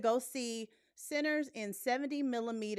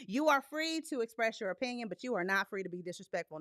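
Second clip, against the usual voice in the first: ordinary talk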